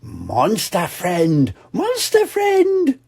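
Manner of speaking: slow, high gleeful cheer; high toddler calling, jumping up and down; excited toddler. Acted. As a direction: bounce with joy. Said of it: "jumping up and down".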